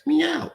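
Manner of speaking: high playful cat meow; mock meow. Streamer mimicking a cat mid-chant, high meow. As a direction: toss in a silly meow.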